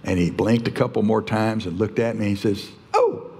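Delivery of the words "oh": a very high, breathless oh that breaks into a laugh; a high startled oh melting into laughter; stunned oh. Retold or imitated. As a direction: react like a man caught off guard. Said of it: "he kinda blinked".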